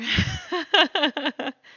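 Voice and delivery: high burst of laughter; bright laugh. Real, not acted. Laugh alone on line.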